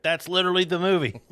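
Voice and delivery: high, fast mock disbelief; deadpan amazement. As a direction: blurt it like it's obvious. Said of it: "I was like".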